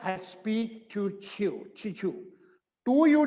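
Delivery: I'll speak to you, very slow, to you joined as chu; teacher drilling the phrase slowly; slow linked demo. Shown as a demonstration. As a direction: link to you into chew slowly. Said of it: "थोड़ा जोड़ना पड़ेगा अपने आप".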